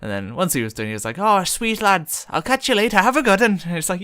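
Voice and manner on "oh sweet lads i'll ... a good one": high, cheery imitation Irish goodbye; hammed-up high Irish voice; bad Irish accent. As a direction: sign off as an Irish stranger. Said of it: "not gonna do a very good Irish accent".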